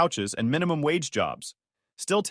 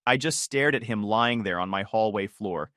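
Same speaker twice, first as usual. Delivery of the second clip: plain reading voice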